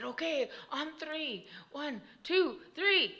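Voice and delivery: high, building loud count; group countdown. A narrator's reading. Children counting before pushing Tokuya, rising loud count.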